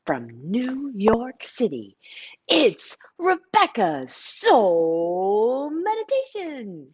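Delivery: slow, drawn-out announcer intro; show intro. Acted. Host opening her stream, slow announcer voice. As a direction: announce the show grandly and slowly.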